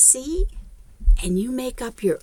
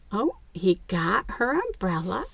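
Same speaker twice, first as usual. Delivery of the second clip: ordinary delivery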